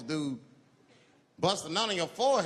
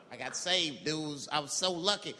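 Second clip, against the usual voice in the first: normal talking voice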